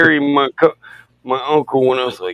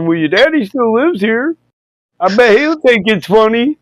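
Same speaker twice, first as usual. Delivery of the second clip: higher boyish voice, mock-innocent; cheeky teen self; 9 semitones higher than usual. Teen son sassing his dad, a higher cheeky boyish voice. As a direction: sass him back like a smart-mouthed kid.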